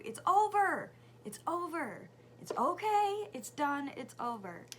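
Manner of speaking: loud, high, insistent repetition; retold emphatic reassurance. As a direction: insist loudly to an upset child. Retold mom insisting to upset son.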